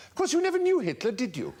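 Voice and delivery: high, quick put-down in a lady's voice; mock-condescending high voice; society lady impression. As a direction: imitate her, pitched high and brisk. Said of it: "she said to me".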